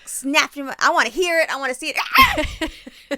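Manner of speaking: high loud gleeful cheer; thrilled and bloodthirsty; excited. Real, not acted. Relishing the mouse getting caught.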